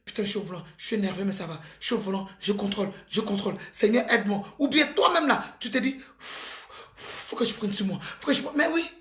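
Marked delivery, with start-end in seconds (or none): 0.1-4.4 lower, softer muttering to yourself; self-calming mutter. Retold or imitated. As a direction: talk yourself down at the wheel, quietly. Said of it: "Faut savoir dire que".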